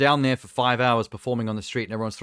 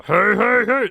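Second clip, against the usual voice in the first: "hey, hey, hey" boomed much louder and higher like Fat Albert; 10 semitones higher, 10 dB louder, slower than usual. Shown as a demonstration. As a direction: shout the cartoon catchphrase in character. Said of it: "Like the".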